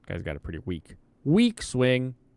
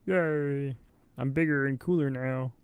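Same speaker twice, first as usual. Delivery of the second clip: lightly voiced, higher, gleeful little unit; cheery unit voice; 6 semitones higher than usual. Streamer voicing his promoted unit, light gleeful put-on voice. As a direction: chirp it like a proud cartoon hero.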